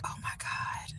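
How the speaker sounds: breathless whispered disbelief; stunned whisper. Real, not acted. To herself after the girl asks her room number, a stunned whisper. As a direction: whisper it like you can't believe what you heard. Stunned reaction to child's question.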